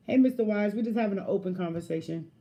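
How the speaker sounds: ordinary talk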